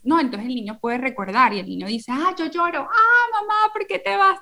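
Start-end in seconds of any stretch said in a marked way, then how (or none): 2.1-4.4 put-on whiny child. Small child crying to his mother as she leaves, high and wailing. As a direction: imitate a little kid wailing for mommy.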